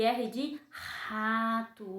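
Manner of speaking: slow "R de rato", R rolled out; drawn-out R. Shown as a demonstration. Teacher showing the R sound to children. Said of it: "O R tem um sonzinho de ra".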